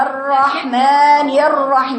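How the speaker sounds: slow "الرحمن الرحيم", ر held a moderate time; medium-length ra. Shown as a demonstration. Teacher demonstrating the middle timing, measured recitation. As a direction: let the doubled ر flow briefly. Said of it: "متوسط".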